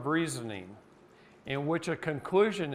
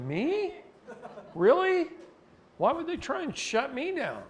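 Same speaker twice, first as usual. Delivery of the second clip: much higher and louder, feigned shock; mock innocent surprise; 14 semitones higher, 6 dB louder than usual. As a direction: play dumb, wide-eyed and innocent.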